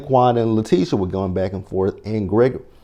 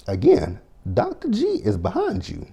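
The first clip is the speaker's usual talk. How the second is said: ordinary talk, nothing marked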